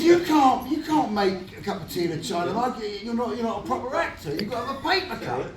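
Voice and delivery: high, loud, snotty mock runner; stroppy runner. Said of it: "a stroppy runner".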